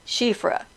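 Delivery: "Shifra" said softly as SHEE-fra; pronunciation demo. Shown as a demonstration. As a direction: say "Shifra" alone as a model, softly. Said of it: "how do you pronounce Shifra".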